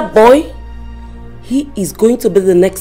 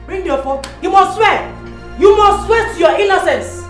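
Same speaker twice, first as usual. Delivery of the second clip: shrill mother insisting on an oath; furious demand; 9 semitones higher than usual. Acted. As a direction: keep hammering him to swear.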